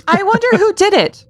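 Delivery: high, mock-innocent wondering; wondering townsfolk. Townsfolk outside next morning follow the trail, mock wondering. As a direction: play puzzled townsfolk in a high voice. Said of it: "The town wonders as they go outside".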